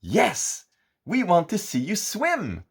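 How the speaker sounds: high excited ask; eager together. A narrator's reading. Goody and Gooda asking the giant to swim, high and eager. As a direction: say it as one, eager. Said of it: "say together".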